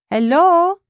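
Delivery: high, loud, questioning hello; calling out. A narrator's reading. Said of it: "a strange voice calls".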